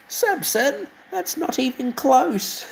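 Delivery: very high, slow cartoon engine voice; scolding engine. Engine telling Samson the bus stop sign is wrong, high squeaky voice.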